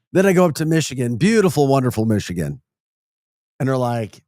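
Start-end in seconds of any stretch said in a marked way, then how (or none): none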